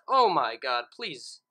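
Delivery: ordinary delivery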